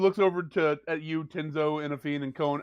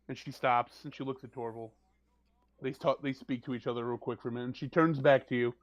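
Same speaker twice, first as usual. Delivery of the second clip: normal talking voice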